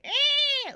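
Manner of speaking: very high, sharp raptor squeal; velociraptor screech. Retold or imitated. Imitating the movie's velociraptors, high squeal. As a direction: squeak like a tiny-armed dinosaur. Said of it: "they were always going".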